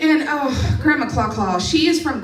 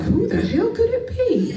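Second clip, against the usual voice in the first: mock innocence; 11 semitones higher than usual. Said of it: "And I look around".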